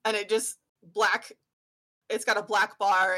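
ordinary talk, nothing marked

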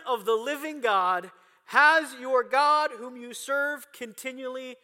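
high, loud anguished cry, drawn out. Acted. King crying out to Daniel at the lions' den in anguish. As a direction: wail it out like a desperate king at the den. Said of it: "The king cried out to Daniel in a tone of anguish".

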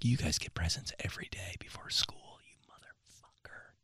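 hushed, envious whisper; jealous whisper. Retold or imitated. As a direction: whisper it with mock resentment. Whispered jealous outburst as kid.

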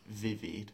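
vivir read with two English v sounds; V as written. Shown as a demonstration. Teacher showing how it would sound if read, V as written. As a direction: say vivir as spelt, with buzzing V's. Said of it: "if we pronounced it as we read it".

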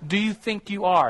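retold explosion. Perfectionist finally exploding at his challenger, imitated slow angry outburst. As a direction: act out someone finally exploding, words drawn out. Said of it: "he finally just exploded".

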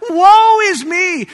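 loud, high, drawn-out wail of woe; cried out. Acted. Isaiah cries out terrified at the vision, loud lament. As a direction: cry it out long and high. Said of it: "He cries out".

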